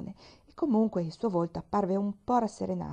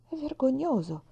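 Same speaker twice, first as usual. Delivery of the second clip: higher, outraged on his behalf; indignant exclamation; 7 semitones higher than usual. Acted. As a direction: cry out in sympathy, pitched up. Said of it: "esclamò Pollyanna solidale". (esclamazione indignata, più acuta)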